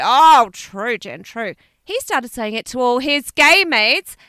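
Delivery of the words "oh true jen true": mimicked catchphrase. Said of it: "always say".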